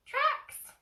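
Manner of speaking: tiny piglet squeaking out one word; high squeak of a piglet. Acted. Piglet voiced.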